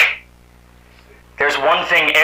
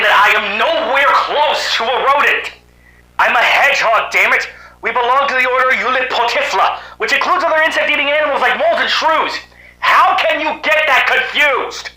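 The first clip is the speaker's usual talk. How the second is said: indignant; 8 semitones higher than usual. Acted. Indignant hedgehog corrects rodent insult.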